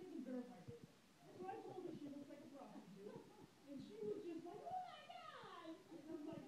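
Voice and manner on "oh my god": mock shocked squeal, much higher and slow; a coworker's appalled, high "oh my God"; imitating shocked gasp. Offended coworker reacts to the insult, mock shocked.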